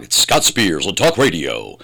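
punchy on-air station ident; radio announcer voice. Shown as a demonstration. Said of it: "flip a switch with how I talk".

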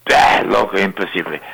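a lightly voiced grumpy old man brushing her off. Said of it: "old man answers".

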